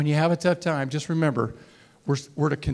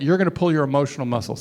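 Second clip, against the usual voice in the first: usual speaking voice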